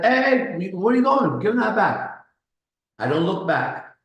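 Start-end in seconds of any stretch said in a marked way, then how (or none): 0.0-2.2 shouting. Retold or imitated. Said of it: "And guy's like".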